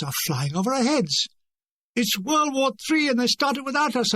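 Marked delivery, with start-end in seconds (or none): 1.9-4.1 a light retold yell while ducking; half-hearted wartime cry; mock shout. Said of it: "I shouted, ducking for cover".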